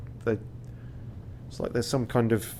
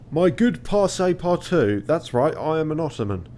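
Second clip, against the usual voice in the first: raised, performed character voice; Ottoman captain; 6 semitones higher, 6 dB louder than usual. Acted. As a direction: play a hearty captain greeting a guest. Voicing Ottoman captain greeting player.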